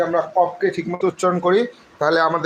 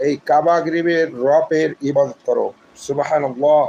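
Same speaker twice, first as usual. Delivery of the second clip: normal talking voice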